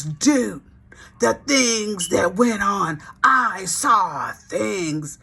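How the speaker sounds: slow, stunned drawn-out words; dazed Fred. Retold or imitated. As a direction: let each word hang like he's still shocked.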